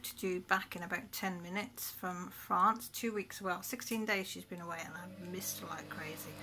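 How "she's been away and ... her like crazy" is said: soft breathy whisper. Real, not acted. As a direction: hush it like a secret. Confiding about missing daughter.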